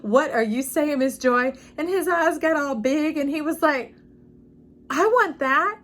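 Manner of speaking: put-on eager kid.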